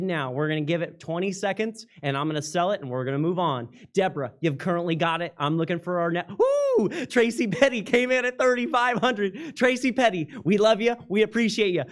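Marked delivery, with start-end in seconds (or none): 6.4-6.9 high excited woo cutting in; host cheering a new bid mid-sentence; sudden whoop. Real, not acted. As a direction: break off with a happy cry.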